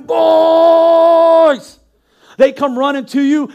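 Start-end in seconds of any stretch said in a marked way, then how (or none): 0.0-1.8 loud high yell of the name; screaming. Retold or imitated. Parent calling the misbehaving boys, loud high yell. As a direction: bellow for the boys across the house. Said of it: "you're screaming".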